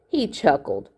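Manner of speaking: usual speaking voice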